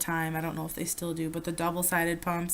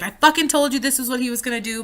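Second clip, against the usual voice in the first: angry rant; 5 semitones higher, 5 dB louder, faster than usual. Retold or imitated. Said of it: "I was really fucking mad".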